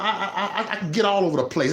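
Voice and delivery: ordinary delivery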